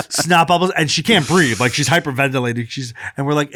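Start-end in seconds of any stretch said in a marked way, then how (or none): none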